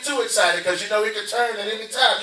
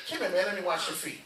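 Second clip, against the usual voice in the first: low and much softer, tender invitation; tender Jesus; 7 semitones lower, 12 dB quieter than usual. Retold or imitated. Jesus tenderly inviting Peter to have his feet washed, soft and low. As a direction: gently call him close, barely above a hush.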